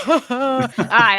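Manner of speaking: warm laugh before reading; a real little laugh, then go on. Real, not acted.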